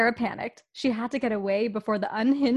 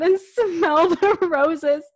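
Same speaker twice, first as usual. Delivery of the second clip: laughing; 7 semitones higher, 7 dB louder, much slower than usual. Real, not acted. Podcast hosts reading fan fiction.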